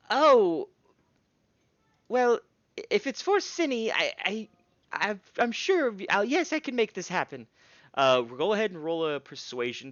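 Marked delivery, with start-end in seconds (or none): 0.1-7.5 higher, excited, fussy smith; gnome smith voice. Acted. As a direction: chatter on like a busy artisan. Smith agrees for Cinny.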